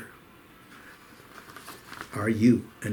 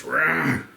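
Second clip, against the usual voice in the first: higher-pitched playful bear "rawr"; bear roar; 4 semitones higher than usual. Acted. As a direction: roar like a little boy playing bear. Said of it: "act like a ring bearer".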